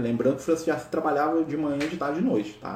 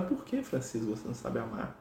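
very soft, understanding question; compassionate; 3 semitones lower, 11 dB quieter than usual. Retold or imitated. Jesus looking compassionately at Francisco, softly understanding. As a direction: drop to a gentle, hushed voice.

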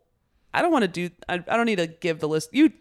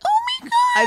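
very high, louder squeal at an animal; squealing little girl; 7 dB louder than usual. Retold or imitated. Women squealing like little girls when an animal is around. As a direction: shriek like a five-year-old seeing a puppy. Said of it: "little, like, five-year-old girls".

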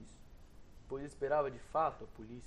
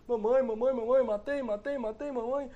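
put-on high, loud panicked child's cry; 10 semitones higher, 11 dB louder than usual. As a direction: wail for mother in terror. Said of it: "e gritando".

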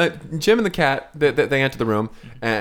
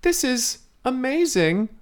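high, slow wondering voice; awestruck child; 6 semitones higher, slower than usual. Acted. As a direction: marvel at it slowly, wide-eyed. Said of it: "he's like".

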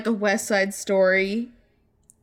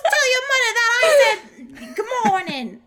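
high, laughing New York newsboy voice; cheeky street kid shouting; newsies accent; 12 semitones higher, 4 dB louder than usual. Pretend. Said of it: "in my newsies voice".

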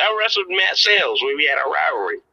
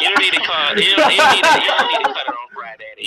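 laughing; 9 semitones higher, 4 dB louder than usual. Real, not acted. Laughing protest at pick.

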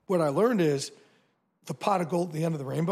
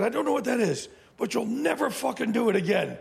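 higher, firm Australian boss laying down the law, lightly imitated; the Australian chairman forbidding it; light Australian boss voice, higher and insistent; 7 semitones higher, 3 dB louder than usual. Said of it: "he's Australian".

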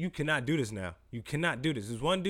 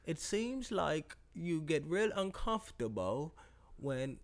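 slow, soft mock British host; British accent; 5 dB quieter, much slower than usual. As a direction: lean in with a smarmy British interviewer. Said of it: "your U K voice".

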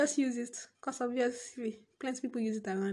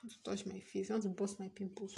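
ordinary delivery

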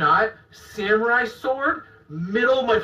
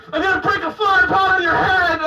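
mock threat; 9 semitones higher, 4 dB louder, faster than usual. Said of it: "He's like".

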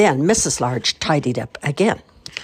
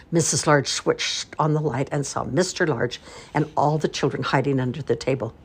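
plain reading voice

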